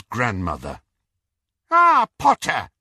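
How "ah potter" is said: crisp, brisk greeting. A narrator's reading. Neville's grandmother greeting Harry, crisp and brisk. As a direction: clip it out like a stern old witch. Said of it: "she said crisply".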